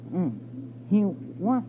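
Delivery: usual speaking voice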